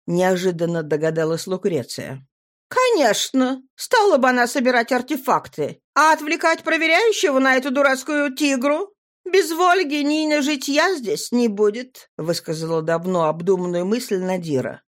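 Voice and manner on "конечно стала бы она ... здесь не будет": put-on higher, earnest young girl. A narrator's reading. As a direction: speak up as a girl. Nadira voiced.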